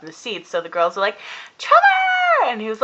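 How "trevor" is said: high, loud mock squeal; imitated fan screaming a name; fangirl scream. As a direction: shriek it like an excited fangirl.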